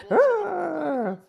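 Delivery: high, drawn-out wail sliding down; hammed-up high cry of protest; mock outburst cry. Preacher playing the man fussing at his wife, mock wail. Acted outburst between soothing replies.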